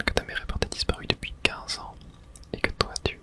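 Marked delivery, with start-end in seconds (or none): none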